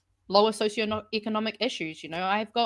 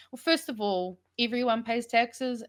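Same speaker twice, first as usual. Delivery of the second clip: normal talking voice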